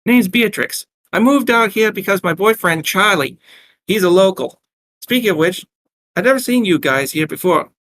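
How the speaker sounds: high, chatty Brooklyn barmaid; Brooklyn accent. Acted. Barmaid introducing herself to two strangers, heavy Brooklyn accent. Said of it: "asked in a heavy Brooklyn accent".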